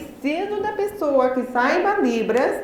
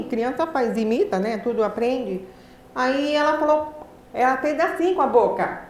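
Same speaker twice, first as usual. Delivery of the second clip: ordinary delivery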